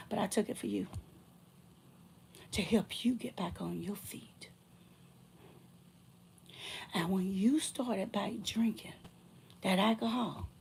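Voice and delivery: soft, breathy whisper; whispered reproach. Real, not acted. Telling the ex about his drinking, whispered. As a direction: sink to a whisper.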